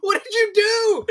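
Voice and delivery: slow, high-pitched loud cry; mock horrified yell. Parent finding water all over kitchen, acted horrified yell. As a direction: draw it out in a loud, high wail.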